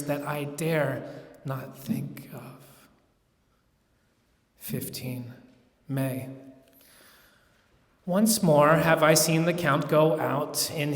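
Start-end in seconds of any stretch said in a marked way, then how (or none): none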